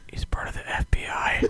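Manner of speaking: hushed conspiratorial whisper. Pretend. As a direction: whisper it like a secret. Joking whisper about Santa.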